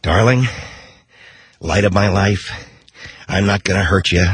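Nicholson impression. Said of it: "just do it better".

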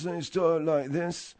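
slowed-down imitation of impaired speech. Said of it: "like he had a physical problem".

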